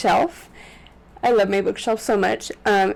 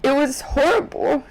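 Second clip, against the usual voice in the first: high, tearful voice breaking into crying; real crying; 5 semitones higher than usual. Real, not acted. Vlogger telling viewers of her dog's death, crying. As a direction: grief welling up, pushing words through tears. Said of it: "I don't wanna ruin my makeup".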